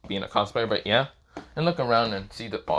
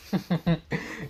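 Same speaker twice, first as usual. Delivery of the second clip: acted chuckle; 6 semitones higher than usual. A narrator's reading. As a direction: chuckle briefly to yourself, amused. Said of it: "Issei's kinda just chuckling at her".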